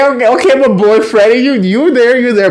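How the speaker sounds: high, excited egging-on; playful high-pitched hyping; hyped cheering. Retold or imitated. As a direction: hype up your boy, pitched high. Narrator hypes up the boy.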